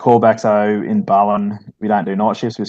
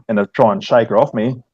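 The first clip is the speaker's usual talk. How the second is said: ordinary delivery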